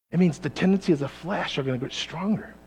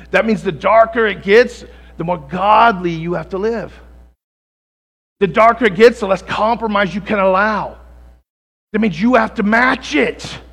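louder, rising preacher's build; preaching at full force; 4 semitones higher, 9 dB louder than usual. Acted. Pastor urging the church, preaching louder. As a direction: drive it home to the congregation.

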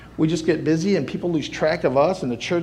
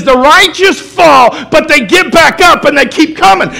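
shirt slogan shouted; 12 semitones higher, 14 dB louder than usual. Retold or imitated. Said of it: "It says".

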